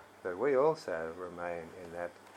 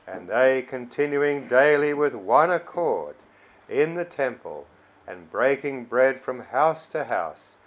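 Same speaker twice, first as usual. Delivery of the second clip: loud, emphatic Bible reading; scripture reading; 2 semitones higher, 5 dB louder than usual. Acted. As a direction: proclaim the verses in measured phrases, key words punched. Reads scripture aloud to congregation.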